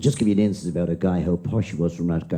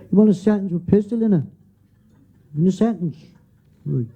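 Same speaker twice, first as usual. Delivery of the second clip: higher, louder cheeky Dublin lad; cheeky pupil; 4 dB louder than usual. Acted. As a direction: blurt it out like a cocky schoolboy. Cheeky pupil answering teacher.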